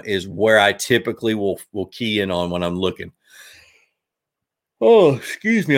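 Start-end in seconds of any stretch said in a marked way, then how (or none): 4.8-5.8 talking through a real yawn. Real, not acted. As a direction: stretch the words out in a yawn. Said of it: "I don't know why I'm yawning".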